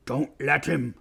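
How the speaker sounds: ordinary delivery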